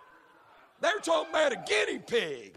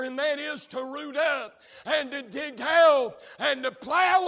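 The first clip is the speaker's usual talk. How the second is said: preaching at full force; 5 dB louder than usual. Real, not acted. Preacher warns his congregation about the boar, full-force preaching. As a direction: thunder each line at the pews.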